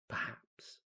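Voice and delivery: a soft unvoiced whispered aside; sinister whisper. Zealot doubts the others' forgiveness, in a sinister whisper. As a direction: breathe it out as a dark afterthought.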